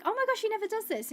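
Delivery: high and fast, thrilled disbelief; amazed. Retold or imitated. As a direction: rush it out in delighted surprise. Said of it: "I was like".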